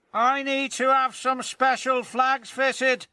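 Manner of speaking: high, snooty engine voice; airily self-important; sniffing James. Acted. James brushing off Emily, high snooty sniff. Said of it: "sniffed James".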